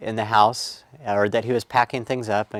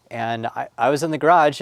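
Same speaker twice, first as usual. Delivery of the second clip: ordinary delivery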